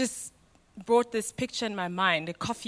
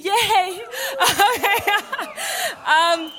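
laughing; 9 semitones higher, 3 dB louder, much slower than usual. Real, not acted. Laughs after crowd cheers coffee.

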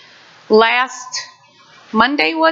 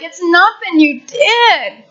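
higher, slow, gentle and comforting; reassuring God; 7 semitones higher, slower than usual. Retold or imitated. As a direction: soothe a frightened child as a loving Father. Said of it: "And He's like".

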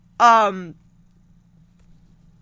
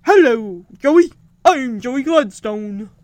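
put-on kid copying Bullwinkle; 3 semitones lower, 4 dB louder than usual. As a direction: echo his goofy moose voice back. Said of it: "And she's like".

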